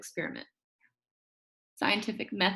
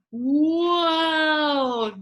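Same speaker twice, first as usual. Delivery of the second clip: whoa; 6 semitones higher, 4 dB louder than usual. Acted. Exclamation of surprise.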